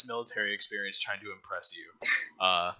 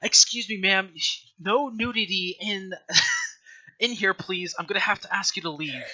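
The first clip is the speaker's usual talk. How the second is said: higher, prim service voice; a politely firm waitress asking someone to leave; waitress telling the topless player to leave; 6 semitones higher, 3 dB louder than usual. Acted. Waitress scolding the dancing player.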